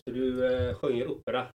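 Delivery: soft, half-swallowed question; quiet, mumbled question; quiet aside. Real, not acted. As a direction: murmur it almost to yourself. Repeats question, first barely audible.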